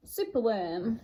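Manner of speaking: usual speaking voice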